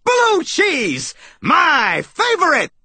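very high, gleeful exclamation; high-pitched cartoon mouse glee; excited squeak. A narrator's reading. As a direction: squeal with delight over a treat. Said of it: "he squeaked".

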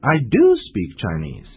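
"do" punched high and firm; stress on do. Shown as a demonstration. Answering a doubt about speaking Chinese, stress on "do". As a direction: hit "do" to correct the listener. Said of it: "Emphatic sentences are usually used after".

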